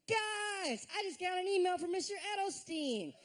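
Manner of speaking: high, giddy mock announcement; hammed-up delighted bragging, high-pitched; gushing excitement. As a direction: gush the fake good news to classmates. Acts out gloating forum post.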